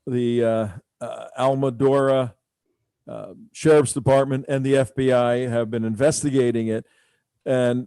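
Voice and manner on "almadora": ordinary talk, nothing marked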